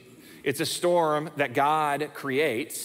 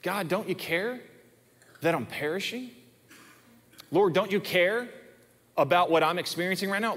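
pleading prayer; 6 semitones higher than usual. Acted. Praying on behalf of sufferers.

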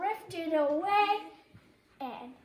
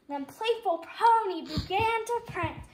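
ordinary delivery, nothing marked

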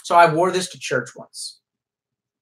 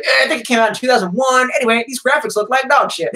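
higher, dismissive reviewer impression with a laugh; mock reviewer voice; 8 semitones higher, 4 dB louder than usual. Streamer mocking his own game review voice, dismissive. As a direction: toss off a snooty game review. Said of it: "makes a review of the, the second Nomad and it's like".

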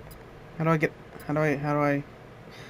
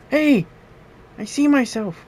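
high, loud excited shout; delighted at seeing himself; 10 semitones higher, 6 dB louder than usual. Real, not acted. Sees himself on screen.

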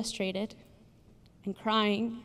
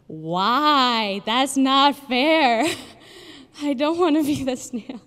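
put-on higher, louder, slow childish protest; enraged; 5 semitones higher, 9 dB louder, slower than usual. Young speaker protesting to mom about the snail story, loud whiny outburst. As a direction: whine it out like an indignant kid. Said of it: "that used to get me so enraged".

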